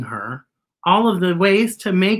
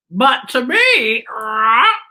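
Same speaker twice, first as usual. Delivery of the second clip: higher-pitched crow voice with a caw; 6 semitones higher, 5 dB louder, slower than usual. Acted. Crow answering the robot about Beatrice, high and cawing. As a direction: squawk it like a talking crow. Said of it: "said the crow".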